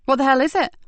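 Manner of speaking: urgent, pressing question; eager demand. A narrator's reading. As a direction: push hard for the answer.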